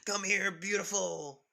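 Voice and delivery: pervy Mineta. Retold or imitated. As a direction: drawl it out like a lech calling a girl over. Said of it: "Mineta says".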